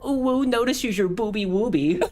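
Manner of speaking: put-on high baby-talk voice; mock cutesy baby talk. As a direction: coo it in a silly cutesy voice. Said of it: "What if you're saying it to a woman?".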